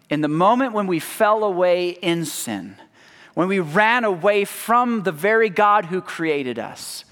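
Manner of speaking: usual speaking voice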